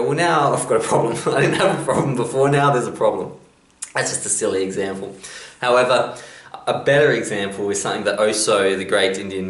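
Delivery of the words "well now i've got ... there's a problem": a hint of a whiny kid, higher and laughing; mock pupil. Pupil answering the teacher, lightly whiny and amused. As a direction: play a pupil suddenly stuck with a problem.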